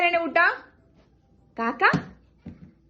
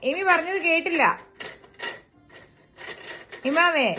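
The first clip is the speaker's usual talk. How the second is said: ordinary talk, nothing marked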